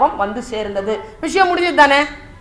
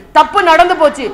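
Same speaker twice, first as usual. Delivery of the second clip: ordinary delivery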